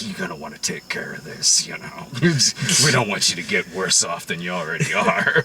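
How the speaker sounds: low near-whispered menace; creepy Whitey. Retold or imitated. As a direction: breathe the threat softly. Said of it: "he's like".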